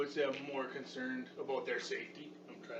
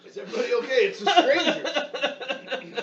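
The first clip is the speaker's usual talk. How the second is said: laughing shout; 11 semitones higher, 15 dB louder than usual. Acted.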